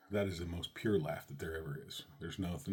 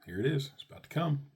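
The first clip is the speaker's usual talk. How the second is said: higher, keyed-up inner voice; bracing himself; 8 semitones higher, 5 dB louder than usual. Retold or imitated. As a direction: psych yourself up for the big moment. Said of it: "And you're like".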